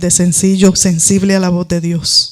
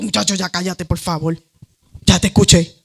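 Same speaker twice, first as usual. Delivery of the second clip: mock exasperated; 3 semitones lower, 5 dB quieter than usual. Imagined God fed up with child.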